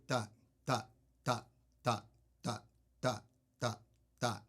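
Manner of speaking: short, evenly spaced "dot" on every beat; steady dot pulse. Shown as a demonstration. Drum teacher voicing the accents heard in a roll, steady and rhythmic. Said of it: "you were hearing this steady".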